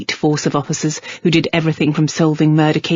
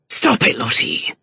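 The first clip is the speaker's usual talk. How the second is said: snarl; 6 semitones higher than usual. A narrator's reading. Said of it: "Gentry snarled".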